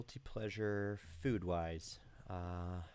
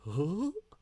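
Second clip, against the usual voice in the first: high, loud cartoon whoop; Goofy; 9 semitones higher, 6 dB louder than usual. Shown as a demonstration. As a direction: hoot upward in a goofy cartoon voice. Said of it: "Can you do Goofy?".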